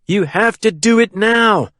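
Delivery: high, insistent command; urgent cry. A narrator's reading. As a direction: cry out, pressing for action at once. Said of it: "he cried".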